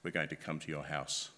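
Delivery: deep, softened voice of menace; threatening caller. Acted. Scam caller threatening the person at home, deep voice. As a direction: pitch it well down, like an intimidating official. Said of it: "they'll have threats".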